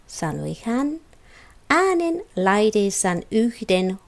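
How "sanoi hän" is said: ordinary delivery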